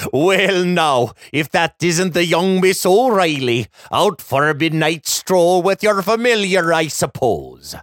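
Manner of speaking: lightly voiced old Irish leprechaun; cheery old leprechaun, Irish lilt. A narrator's reading. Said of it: "Mister McGrady pats Clover's flank".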